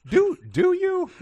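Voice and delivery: very high, loud, slow disbelief; incredulous. Real, not acted. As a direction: squeak it in stunned sarcasm. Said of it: "And I just had to stop".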